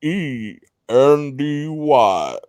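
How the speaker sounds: W E N D Y spelled letter by letter, very slow; slow spelling. Pretend. Host spelling the name Wendy very slowly. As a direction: spell it out one letter at a time.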